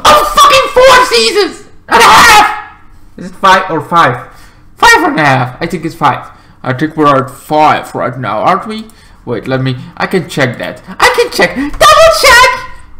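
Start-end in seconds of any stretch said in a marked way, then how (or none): 0.0-2.8 high shriek; mock exasperation. Mock exasperation at recap size. 10.9-13.0 very high, rising squeal; playful squeal. Pretend. Clowning while checking.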